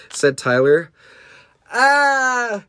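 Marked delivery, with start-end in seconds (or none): 1.7-2.6 high, sustained cartoon scream; high "ah" held out; acted scream. A narrator's reading. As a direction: scream like a creature under attack. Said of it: "screamed the de".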